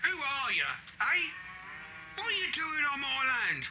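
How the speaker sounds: high-pitched, hostile demand; angry challenge. Acted. Farmer confronting a man on his land, angry challenge. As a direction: confront the intruder, pitch pushed up.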